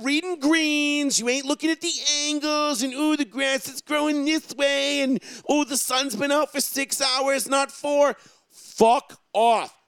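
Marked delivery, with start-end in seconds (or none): 2.9-5.0 higher, slow mock fussy voice; mocking fussy golfer. Mocking fussy golfers reading greens. 5.5-8.2 higher, slow mock fussy voice; mocking fussy golfer. Mocking fussy golfers reading greens.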